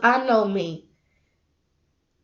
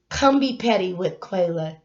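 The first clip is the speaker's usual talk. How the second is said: ordinary talk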